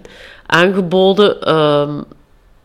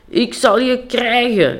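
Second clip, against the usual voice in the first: slow, higher rooster threat; angry rooster; 5 semitones higher, slower than usual. Acted. Angry rooster chasing a hen through the bedroom, slow high threat. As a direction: growl it out as a cocky rooster. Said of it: "riep de haan".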